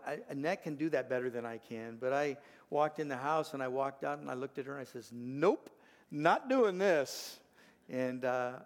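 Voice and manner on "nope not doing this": loud, drawn-out, rising refusal; mock refusal. Grandfather refusing to let grandson scream, loud mock refusal. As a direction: put your foot down, big and loud.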